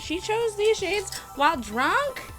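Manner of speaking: high mock disbelief; incredulous. Real, not acted. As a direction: ask it in teasing disbelief. Mocking friend's drunk choices.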